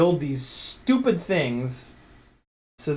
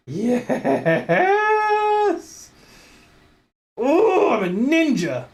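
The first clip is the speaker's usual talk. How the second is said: high, loud victory boast; excited cheer; 11 semitones higher, 5 dB louder, much slower than usual. Real, not acted. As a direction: crow about winning. Success celebrated.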